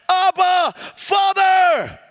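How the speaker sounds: high, loud, drawn-out cry. Acted. Believers crying out to God as Father, a full shout. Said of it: "by which we cry out".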